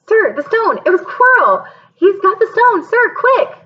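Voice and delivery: high, loud panicky urgency; urgent alarm. Acted. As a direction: blurt it out in a rush of alarm.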